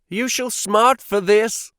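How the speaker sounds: high, loud furious threat. A narrator's reading. Suitor Strangerson threatening the farmer, furious and loud. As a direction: spit the threat out in rage.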